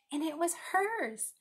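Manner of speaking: usual speaking voice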